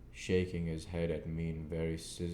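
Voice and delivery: ordinary delivery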